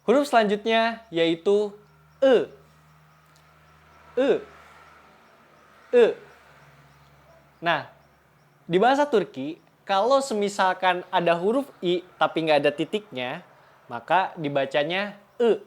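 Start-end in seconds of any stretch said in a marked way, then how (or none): 2.2-6.1 "e" as an unrounded "eu", like Sundanese. Shown as a demonstration. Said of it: "mirip seperti huruf E di bahasa Sunda". 15.4-15.6 "e" as an unrounded "eu", like Sundanese. Shown as a demonstration. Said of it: "mirip seperti huruf E di bahasa Sunda".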